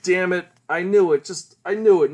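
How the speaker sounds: loud, frustrated outburst, acted; exasperated. Dying boy cursing his bad luck, exasperated. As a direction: curse your luck with force.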